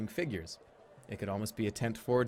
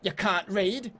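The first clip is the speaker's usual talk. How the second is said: higher, slow cutesy goblin voice; half-goblin barmaid; 6 semitones higher, 3 dB louder, slower than usual. Acted. Half-goblin barmaid telling the player the beer prices, higher and slow. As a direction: talk down sweetly like a goblin barkeep.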